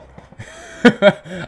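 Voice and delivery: loud burst of laughter at himself. Real, not acted. Laughs at own misreading.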